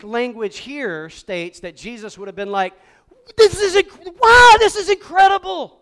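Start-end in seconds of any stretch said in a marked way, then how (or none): 3.4-5.8 much higher and much louder, bursting with wonder; excited. Pretend. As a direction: shout out the amazement. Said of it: "That would have been Jesus".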